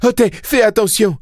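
put-on very high boy's scream; yelled warning. A narrator's reading. Boy warning his animal of the oncoming car, high yell. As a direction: shriek a warning. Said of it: "un hurlement".

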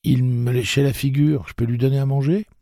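put-on high, fast, eager child's plea; eager boy. A narrator's reading. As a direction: beg excitedly as a kid. Boy voiced in story.

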